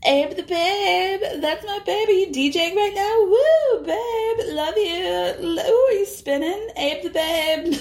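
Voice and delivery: put-on high, slow, squealing drunk coo; drunk gushing. Bryn drunkenly gushing at Abe on the phone, high squealing coo. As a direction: gush over him like a tipsy fan. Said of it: "Bryn takes the phone from Erin and is like".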